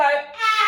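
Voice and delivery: loud, high shouted ah; a big release scream. Shown as a demonstration. Yoga teacher leads the last big shouted ah. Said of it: "A big scream, a big shout, just go".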